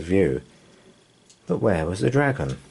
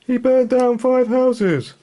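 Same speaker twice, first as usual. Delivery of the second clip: much higher, loud, slow indignant cry; outraged villager; 5 dB louder than usual. Acted. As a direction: complain to George in outrage. Villagers complain.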